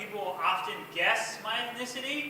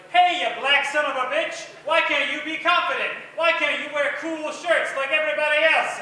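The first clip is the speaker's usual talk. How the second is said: mock angry yell; 5 semitones higher, 7 dB louder than usual. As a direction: bark it like a cranky old bigot.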